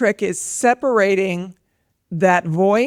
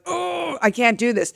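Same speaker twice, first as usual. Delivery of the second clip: frustrated groan; 5 semitones higher than usual. Retold or imitated. Mother imitates daughter stuck on homework, frustrated whine. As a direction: imitate a kid giving up on homework. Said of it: "when I hear her ... and she's like".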